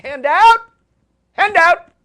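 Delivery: loud playful shout. Real, not acted. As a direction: call out loudly. Calling out for the handout.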